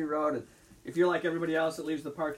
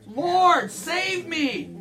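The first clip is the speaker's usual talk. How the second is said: higher, louder desperate cry; cry for help; 10 semitones higher, 6 dB louder, much slower than usual. Acted. Peter sinking in the water cries out to the Lord. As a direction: call out like a sinking man.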